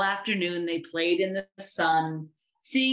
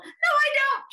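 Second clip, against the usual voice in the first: very high little-voice squeal; shriek; 4 dB louder than usual. A narrator's reading. Little voice refuses a cuddle, shrieking. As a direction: shriek the protest at the top of the range. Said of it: "shrieked the voice".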